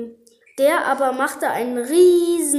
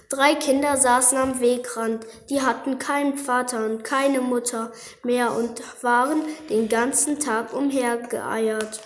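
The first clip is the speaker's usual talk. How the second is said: ordinary delivery, nothing marked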